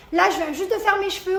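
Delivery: normal talking voice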